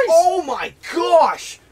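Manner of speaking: slow, high, loud stunned cry; shocked shout. Real, not acted. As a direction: draw it out in sheer disbelief. Fish finally landed.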